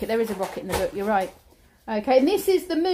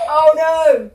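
laughing oh no, louder; 3 semitones higher, 6 dB louder than usual. Real, not acted. Mother laughing with her child, loud oh no. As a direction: crack up at the silly wolf.

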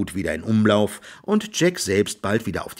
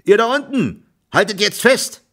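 loud, high shouted order; high-pitched carrying call; 12 semitones higher, 5 dB louder than usual. A narrator's reading. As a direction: shout down into a well. Calling down into the well.

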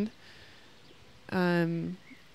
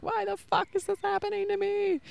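mock crying wail; 13 semitones higher than usual. Retelling her existential crisis to viewers, a high whiny mock wail. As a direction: wail it like you're half in tears, imitating yourself. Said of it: "a lot of crying and me just being like".

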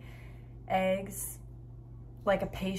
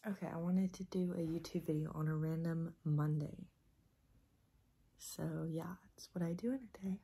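early morning whisper; 5 dB quieter than usual. Real, not acted. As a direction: whisper so nobody wakes. Just woke up, filming at morning.

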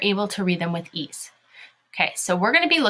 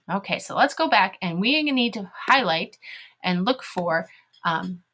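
ordinary delivery

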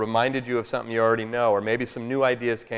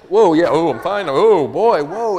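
higher, louder, overwhelmed, fighting back tears; choked up; 7 semitones higher, 13 dB louder, slower than usual. Retold or imitated. A dad reassuring his daughter while overcome with tears, choked-up gasps. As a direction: wave off the tears while they break through. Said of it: "And then I burst into tears".

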